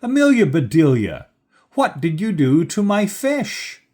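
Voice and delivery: high, loud angry demand; outraged shout. A narrator's reading. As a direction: demand an explanation in disbelief. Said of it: "he shouted".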